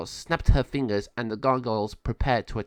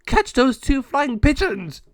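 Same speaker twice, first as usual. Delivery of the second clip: high, loud, drawn-out squawked order; cartoon henchman yelling orders; shouting gargoyle; 8 dB louder, slower than usual. El Jago ordering gargoyles after the birds, shouting.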